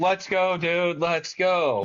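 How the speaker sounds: high, drawn-out urging; hyping up a buddy. Acted. Voicing how Mike should back Dustin, urging him on. Voicing a hyped-up wingman friend.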